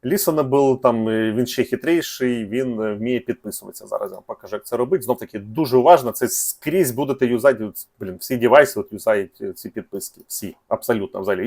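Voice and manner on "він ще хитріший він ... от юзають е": usual speaking voice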